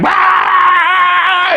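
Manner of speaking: high shouted nightmare cat shriek; jump-scare yelp like a creepy puppet; mock scream. Imitating the felt cats.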